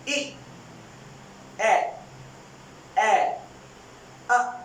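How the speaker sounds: short vowels said one by one; vowel drill. Shown as a demonstration. Teacher repeats four vowel sounds for students. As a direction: model each vowel with a pause. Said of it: "मैं repeat करता हूं".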